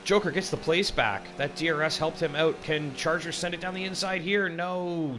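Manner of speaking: high, loud excited race call; motorsport commentator. Real, not acted. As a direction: shout the battle as it unfolds. Wheel to wheel battle call.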